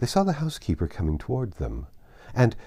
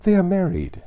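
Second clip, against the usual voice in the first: eager hope; 9 semitones higher, 5 dB louder than usual. A narrator's reading. Jane hopes for marriage.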